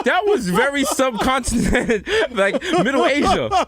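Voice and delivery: high, giggling disbelief; laughing. Real, not acted. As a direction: laugh while teasing. Laughing at the accent.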